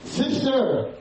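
very high, sudden cry of recognition; screamed. A narrator's reading. Boy in the dark room calling to his sister, a high scream. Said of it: "And he screamed to her".

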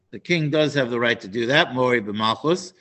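ordinary talk, nothing marked

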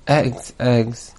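low, soft puzzled musing; puzzled husband. Acted. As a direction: murmur it, trying to remember. Forgetful husband recalls the errand.

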